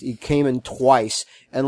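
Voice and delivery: ordinary talk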